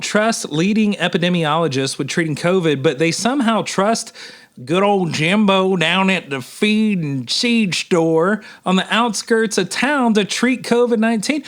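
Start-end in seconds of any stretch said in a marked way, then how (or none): 4.6-8.4 slow Southern country drawl, lightly put on; country accent. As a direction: drawl it like a rural Southern local. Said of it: "I said that with a country accent".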